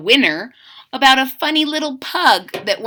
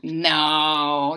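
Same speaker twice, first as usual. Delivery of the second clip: ordinary delivery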